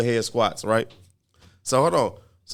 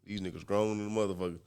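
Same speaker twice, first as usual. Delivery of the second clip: muttered, much lower and softer; Caden's mutter; 6 semitones lower, 12 dB quieter than usual. Retold or imitated. Imitating the ninth grader commenting on them, muttered. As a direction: mumble it under your breath like a bored kid. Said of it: "Caden ... doing jumping jacks like".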